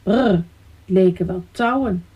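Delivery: ordinary delivery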